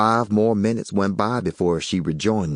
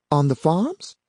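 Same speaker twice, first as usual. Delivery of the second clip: higher woman's voice; Yvonne's voice; 10 semitones higher than usual. A narrator's reading. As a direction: read her lines a bit higher.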